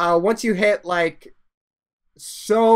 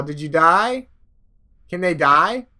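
ordinary delivery

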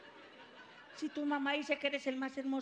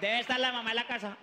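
ordinary talk

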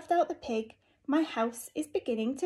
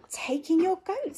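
high bright voice for the wise man; wise old sage; 7 semitones higher, 3 dB louder than usual. Acted. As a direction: give the advice cheerfully. Wise old man's advice.

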